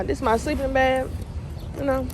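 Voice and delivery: ordinary talk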